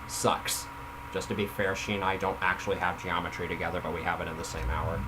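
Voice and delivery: usual speaking voice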